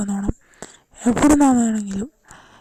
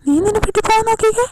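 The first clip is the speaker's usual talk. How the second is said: much higher, fast, frantic; panicked man; 8 semitones higher, faster than usual. Acted. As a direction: shoo her out in a high panic. Panicked man shoos girl out. (പേടിച്ചരണ്ട, ഉയർന്ന സ്വരത്തിൽ)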